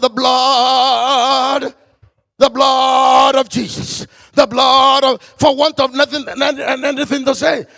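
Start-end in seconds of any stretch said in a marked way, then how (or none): 0.0-5.2 loud, slow building cry; retold shout. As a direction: shout it over the crying children. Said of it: "and I will shout".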